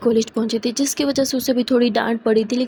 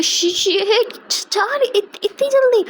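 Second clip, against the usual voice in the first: high, stammered shock; 10 semitones higher, much slower than usual. Acted. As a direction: stumble over the words, voice jumping up. Checks clock, shocked.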